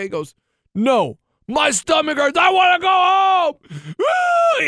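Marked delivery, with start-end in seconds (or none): 0.7-3.5 high, loud mock wail of a kid; child starting to cry. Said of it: "He starts crying". 4.0-4.7 very high mock wail; imitated child's crying. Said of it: "He starts crying".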